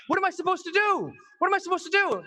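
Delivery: high, urgent mock-panicked repetition; retold panic. Panicking student skydiver to his instructor, acted high and urgent. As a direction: act out a rising panic over the instructions. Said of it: "I start having a panic".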